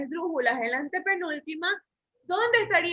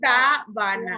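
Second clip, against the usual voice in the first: Sabana slowly split, SA loud and long; stress on SA; 4 semitones lower, 5 dB louder, slower than usual. Shown as a demonstration. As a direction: punch the first syllable of Sabana, then separate the rest. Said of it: "Lleva el acento en sa".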